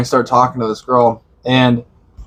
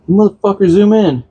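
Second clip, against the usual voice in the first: frustrated at computer; 8 semitones higher than usual. Real, not acted. Fighting with the map app.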